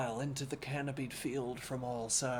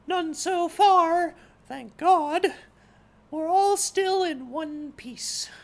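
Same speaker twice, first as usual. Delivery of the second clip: much higher, louder, slow youthful voice; sprightly youth; 8 dB louder, slower than usual. A narrator's reading. Sprightly youth answers the horseman, high and loud. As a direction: pipe up like a bright young lad.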